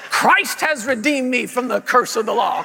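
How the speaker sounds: firm, declarative preacher delivery; imitated parent confessing scripture; parent leading confession. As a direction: state it for the child to repeat. Parent's take before child repeats.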